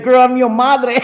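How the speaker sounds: high, loud laughing retort as mom; mock sassy mom. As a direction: play the mom snapping back through laughter. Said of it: "She's gonna be like".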